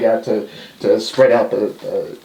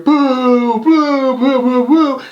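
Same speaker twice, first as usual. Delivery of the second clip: higher mock crowd boos; crowd booing; 10 semitones higher, much slower than usual. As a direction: boo like the arena.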